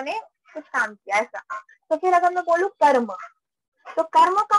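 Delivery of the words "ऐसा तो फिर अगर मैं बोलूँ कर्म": ordinary talk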